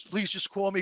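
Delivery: low, very soft helper's voice; hushed, gentle retelling; retold kindly reassurance. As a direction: imitate a nice man reassuring someone. Voicing the kind helper.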